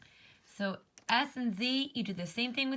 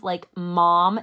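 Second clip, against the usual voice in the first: mom said much louder with a blocked nose; stuffed-nose mom; 2 semitones lower, 12 dB louder than usual. Shown as a demonstration. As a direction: say mom like your nose is plugged. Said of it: "it kinda sounds like bub".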